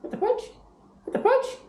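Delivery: very high squeaky "What the fudge?"; parrot phrase prompt. Shown as a demonstration. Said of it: "can you say".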